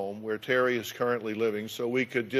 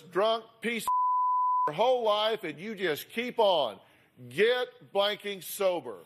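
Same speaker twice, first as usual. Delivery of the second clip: reading texts aloud; 8 semitones higher than usual. Acted. Host reads text messages aloud.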